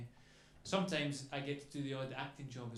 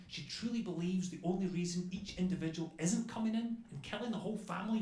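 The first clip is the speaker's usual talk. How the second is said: normal talking voice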